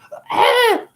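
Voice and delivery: shrieked gasp of laughter; high laughing yelp. Real, not acted. Teasing the women listening, high laughing yelp.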